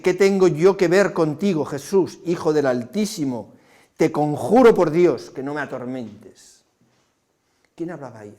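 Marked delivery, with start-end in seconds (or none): 0.0-6.6 demon shouting. Acted. The possessed man shouts at Jesus with a great voice, loud and desperate. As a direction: bellow it like a demon cornered by Jesus. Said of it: "y gritando con gran voz le dice".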